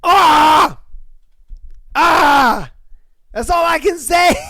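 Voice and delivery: shouting high and loud in disbelief; outraged shouting. Real, not acted. Vlogger reacting to a cheating story, shouting. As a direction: yell out the outrage.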